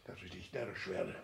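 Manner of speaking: breathless whispered despair. Acted. Father muttering to himself in despair, whispered.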